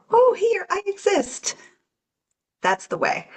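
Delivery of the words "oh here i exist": 1 awed discovery. Pretend. Inner self discovering it exists.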